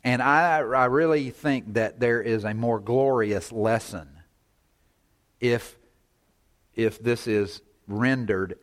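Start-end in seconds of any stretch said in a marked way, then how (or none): none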